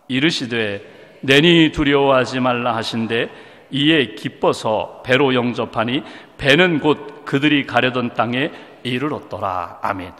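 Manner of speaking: slow, low-pitched Bible reading; measured, deep-pitched reading to a congregation; solemn scripture reading. Acted. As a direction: read the verse out slowly and low, closing on amen. Reads the scripture aloud to congregation.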